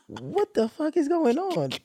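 drawn-out, mock-baffled question; bewildered disbelief. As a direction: ask it like you can't believe your eyes. Sister cries again at graduation.

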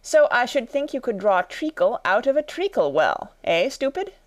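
high-pitched, put-on know-it-all voice; scornful Hatter. Hatter explaining treacle wells to Alice, scornful. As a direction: lecture high, ending on a taunt.

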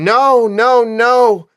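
high, loud cry of "no"; screaming. A narrator's reading. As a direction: scream it out in horror, narrating.

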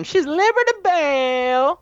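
high, drawn-out showy character voice; Liberty Belle. Retold or imitated. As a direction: sing out the name, hammed-up and high. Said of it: "got her accent back".

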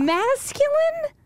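drawn-out, soaring disbelieving question; incredulous. Real, not acted. Host baffled by the commentator's word choice, high incredulous drawl. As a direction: stretch it slowly, pitch shooting way up.

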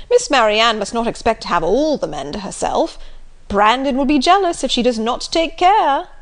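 loud, bluff and teasing; jolly loud teasing squire; teasing hearty squire. Acted. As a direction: rib them jovially about catching him. Sir John voiced.

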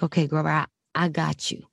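calm a scared actor gently; hushed, fading comfort; reassuring murmur. Retold or imitated. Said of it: "And I was like".

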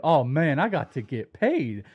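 slow, drawn-out ballplayer voice; Blake Snell impression. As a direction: do a slow impersonation of a pitcher. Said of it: "impersonation of him".

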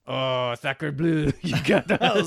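high, slow hammed-up French accent. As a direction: camp French accent, stretched out. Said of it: "that's not French".

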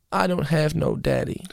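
put-on sad little kid. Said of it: "He's like".

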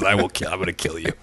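low, quick, quiet mock threat. Imitating the driver's sudden threat, low and quick. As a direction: growl it fast and low. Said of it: "fucking goes".

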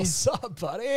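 lightly imitated surfer-dude greeting; John Berthoud. Shown as a demonstration. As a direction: echo the laid-back bro hello. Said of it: "Say it like real John Berthoud would say it".

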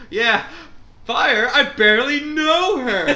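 high, loud, laughing put-on voice; mock campfire banter. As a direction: ham up a fake campfire joke. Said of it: "everyone's pretending like they're around the campfire going".

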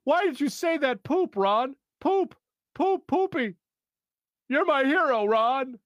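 high, sing-song goofy voice; gleeful childish high delivery; Chris Parnell impression. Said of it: "I captured the cadence of, uh, Chris Parnell".

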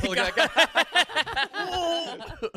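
laughing mock voice. Mocking a guy on video.

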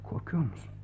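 hushed, unvoiced worried question; concerned whisper. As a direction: whisper it in alarm. (endişeli fısıltı)

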